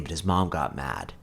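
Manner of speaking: ordinary delivery, nothing marked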